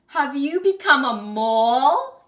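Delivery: loud, high, slow jeering schoolboy; shouted playground taunt; taunting bully. A narrator's reading. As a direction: sneer it out at her, loud and drawn out. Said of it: "he asked".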